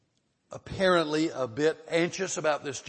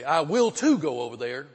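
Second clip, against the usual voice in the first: loud, insistent stress on "will too"; emphatic; 8 dB louder than usual. Shown as a demonstration. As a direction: punch TOO in "will too" like contradicting someone. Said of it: "emphatic. It's kinda like we say".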